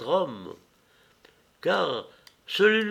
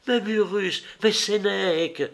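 higher-pitched cry of protest; exclamation; 6 semitones higher than usual. A narrator's reading. Acté objects to the unknown woman's account, exclaiming. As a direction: mildly exclaim the two names, pitched up. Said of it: "s'écria Acté".

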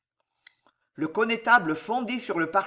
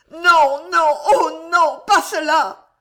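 high, much louder gasping scream of refusal; heartrending cry; 10 semitones higher, 9 dB louder, slower than usual. Acted. Jeanne refusing the renunciation: high, loud heartrending cry. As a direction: tear the refusal out of her. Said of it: "haleta Jeanne dans un cri déchirant".

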